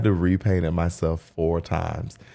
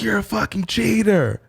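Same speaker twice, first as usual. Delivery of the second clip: angry accusation; 14 semitones higher than usual. Retold or imitated. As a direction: throw the accusation at him, shrill with rage. Ex yelling accusation on phone.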